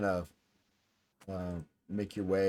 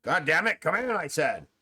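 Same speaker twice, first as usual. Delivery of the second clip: irritated call, higher and louder; upset; 7 semitones higher, 4 dB louder than usual. Acted. Upset man at desk orders the unseen visitor inside, irritated call. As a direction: order them in with raised voice. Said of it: "sounds like he's upset".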